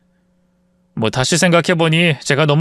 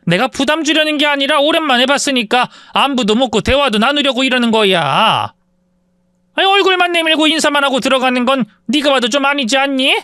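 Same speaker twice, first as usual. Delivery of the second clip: mother's voice pitched much higher, pleading; 9 semitones higher than usual. Retold or imitated. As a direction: coax the son, voice raised high. Mother pleads with her son. (아들에게 높은 목소리로 호소하는 엄마)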